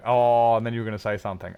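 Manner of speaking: ordinary delivery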